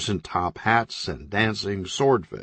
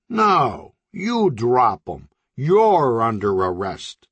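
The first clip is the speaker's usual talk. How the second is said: slow, deliberate tough-guy counter; slow tough cop; 2 semitones higher, 3 dB louder, slower than usual. Acted. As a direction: lay it down, slow and firm. Floral shirt counters, slow.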